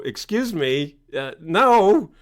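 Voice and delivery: loud, high firm refusal; stern parent shutting down son; indignant parent. Retold or imitated. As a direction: put the kid in their place. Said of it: "anyone with half a brain would go".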